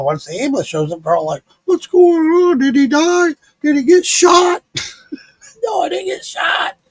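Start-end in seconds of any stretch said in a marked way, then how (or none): 1.7-4.6 high, louder excited clamour; nosy neighbours. Retold or imitated. Nosy neighbours voiced as they crowd round. 5.6-6.8 very high-pitched denial; exasperated retort. Retold or imitated. His retort to the neighbours.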